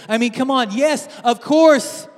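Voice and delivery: high, loud, cocky show-off voice; boastful Jesus. Acted. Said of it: "he's saying".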